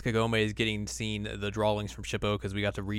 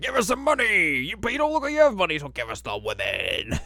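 much higher, louder goofy bandit; hammed-up bandit demands; cartoon bandit shout; 9 semitones higher, 5 dB louder than usual. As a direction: bark it like cartoon thugs. Voicing bandits.

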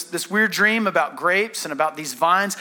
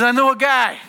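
higher, louder announcement; raised voice; 5 semitones higher, 5 dB louder than usual. Acted. Cupbearer speaking up to Pharaoh in a raised voice. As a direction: pipe up loud and high. Cupbearer's line redone after Italian take.

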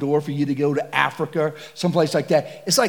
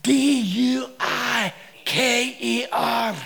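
slow spelling; 7 semitones higher, much slower than usual. Retold or imitated. Guide spelling slowly.

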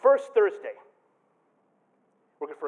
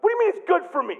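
indignant retort; 13 semitones higher, 6 dB louder, faster than usual. Retold or imitated. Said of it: "I'm like".

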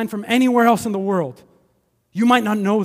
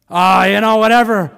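louder, offhand brush-off; dismissive shrug; 8 dB louder than usual. Retold or imitated. Indifferent person shrugging off city pain, loud and offhand. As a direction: wave it away.

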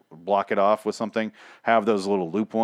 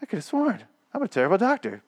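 much higher, flustered mock doctor; 10 semitones higher than usual. The doctor surprised that Paul survived, flustered and high. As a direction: squeak it like a caught quack. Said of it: "the doctor's like".

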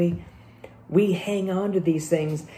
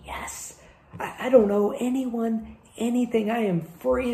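hushed yes, then higher-pitched elation; excited relief; 6 semitones higher than usual. Acted. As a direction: lightly act out giddy relief at being freed. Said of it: "He's so excited to tell".

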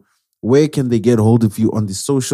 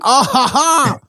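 mock hearty laugh; 11 semitones higher, much slower than usual. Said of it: "pretend to be like".

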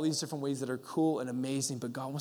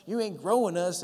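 imitating a pastor's funny accent, higher-pitched; higher-voiced mock complaint to God in an odd accent; 5 semitones higher than usual. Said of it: "I don't know what kinda accent he has".

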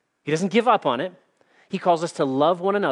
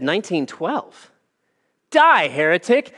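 disgusted; 3 semitones higher, 6 dB louder than usual. Retold or imitated. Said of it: "he looked disgusted and said".

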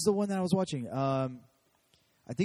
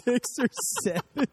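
mock high voice; 7 semitones higher, 5 dB louder than usual. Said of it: "And I'm over here like".

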